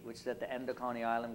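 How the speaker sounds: ordinary delivery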